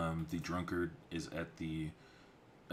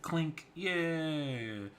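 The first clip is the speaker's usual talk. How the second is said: clink sound effect; 11 semitones higher, 6 dB louder than usual. Retold or imitated. Glasses clinking in tavern scene.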